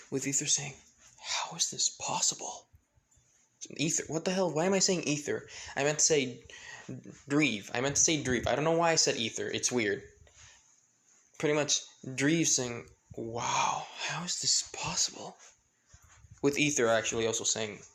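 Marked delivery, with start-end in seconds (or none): 1.2-2.7 awed whisper. Acted. Ether amazed at the wind. 13.1-15.4 awed whisper. Acted. Dreve amazed at the wind.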